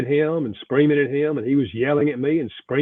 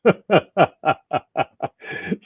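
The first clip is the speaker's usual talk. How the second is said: a real laugh at his own joke; warm chuckle; 5 semitones higher, 4 dB louder than usual. Real, not acted. Laughing after telling the host he didn't like snakes. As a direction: let a chuckle roll out.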